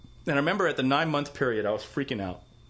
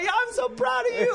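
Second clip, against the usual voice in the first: high-pitched, gushing proud father; excited Chinese-accented dad. Retold or imitated. Dad answering his son's question, high and excited. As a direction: mimic an overjoyed Chinese dad. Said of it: "he's never this excited".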